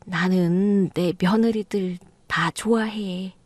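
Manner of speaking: slow, breathy whisper, almost to herself. Acted. Mother-in-law whispering to her daughters-in-law that she loves them. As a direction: murmur it slowly under your breath, unconvinced. Mother's half-hearted claim of love.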